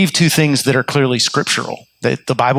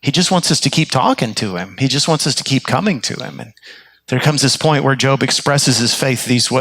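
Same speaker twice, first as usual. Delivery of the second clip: ordinary talk, nothing marked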